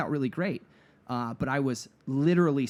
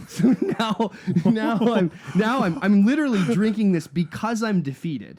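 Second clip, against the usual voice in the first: higher and louder, laughing through the words; can't stop laughing as you tell it; laughing while telling; 8 semitones higher, 8 dB louder than usual. Real, not acted. Laughing at his own story.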